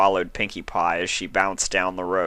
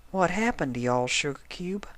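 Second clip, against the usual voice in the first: lightly voiced country mare trailing into a whisper; concerned hush; soft Applejack; 10 semitones higher, 4 dB quieter than usual. A narrator's reading. Applejack asking Spike, falling to a whisper.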